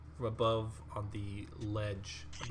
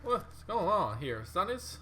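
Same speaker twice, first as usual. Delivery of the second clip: lightly voiced bewildered stranger, rising higher; puzzled, a bit higher and louder as it goes; strange voice from above; 8 semitones higher, 9 dB louder than usual. Game master voicing a confused stranger to the party, bewildered. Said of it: "you just hear this voice".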